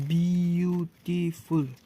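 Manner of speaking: teacher counting out the beats of beautiful, very drawn out; syllable by syllable. Shown as a demonstration. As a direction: break beautiful into long, separate syllables. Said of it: "चार तो syllable है".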